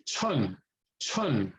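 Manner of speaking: "chun" with a puff of air after "ch"; separate, falling "chun" with breathy onset; aspirated ch. Shown as a demonstration. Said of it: "Aspirating that first one".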